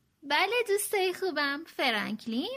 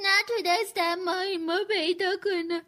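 hammed-up sobs, then a high, loud wail; tearful storybook sobbing, raised in pitch; 5 semitones higher, 5 dB louder than usual. A narrator's reading. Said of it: "حق حق کنان گفت".

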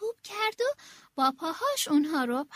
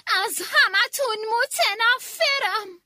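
loud, higher, slow furious roar; roaring rage; 6 semitones higher, 7 dB louder, slower than usual. Acted. Queen roaring her hatred at everyone. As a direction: storm out each word.

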